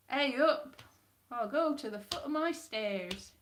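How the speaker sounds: Yorkshire attempt slipping into West Country; botched Yorkshire accent. Shown as a demonstration. Performer practises Yorkshire catchphrases, accent drifting West Country. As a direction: put on a bad Yorkshire accent. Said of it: "you've gone West Country again".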